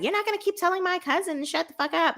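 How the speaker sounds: high, fast mock telling-off; indignant retort. Portia's angry retort, retold.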